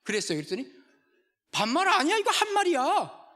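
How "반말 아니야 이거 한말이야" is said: high, loud cheeky comeback; cheeky waiter. Retold or imitated. Pastor telling a YouTube joke, sassy waiter talking back.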